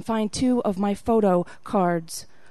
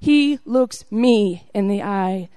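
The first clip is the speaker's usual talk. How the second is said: plain reading voice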